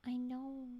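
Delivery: ordinary talk, nothing marked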